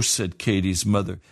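ordinary delivery

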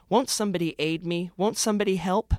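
hammed-up cry for help; pleading cry. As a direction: beg desperately for rescue. Narrator begging for help.

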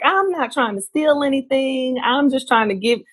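high, pleading disbelief; indignant protest. Retold or imitated. To immigration officers treating her like a criminal, high indignant protest. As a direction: insist you are innocent, voice jumping up.

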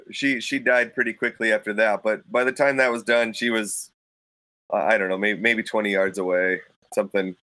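ordinary delivery

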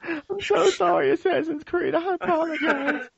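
mock apology. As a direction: grovel in a high falsetto, dragging it out. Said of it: "I'm gonna come back next episode and be like".

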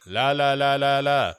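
lightly sung la la la, ears covered; la la la to block out. Retold or imitated. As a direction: sing la la to drown her out. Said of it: "make this noise".

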